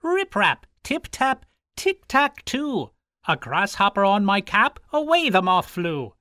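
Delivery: high, sing-song Irish leprechaun voice; merry high Irish fairy chant; leprechaun's song. Acted. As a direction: chant it like a cobbler elf at work. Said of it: "I do use an Irish accent at times".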